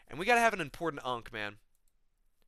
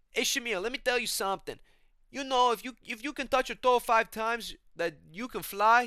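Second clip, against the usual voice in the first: high, loud put-on voice; Moicano; 10 semitones higher, 6 dB louder than usual. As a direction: pitch way up to feed him a tall tale, slowing later. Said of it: "he keeps telling Shamil things".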